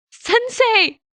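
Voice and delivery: put-on high, loud boyish shout of joy; delighted high yell of a boy; joyful cry. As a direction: call out as you run to him. Boy rushes to hug teacher.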